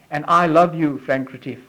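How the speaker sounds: low, softened loving answer; God's tender reply. Acted. God answers his child by name, tender reply. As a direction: drop low and reassure, unhurried. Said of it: "And that is God saying".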